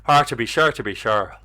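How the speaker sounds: lightly put-on Irish accent; mock Irish accent. Streamer jokingly doing an Irish voice for chat, mild Irish accent.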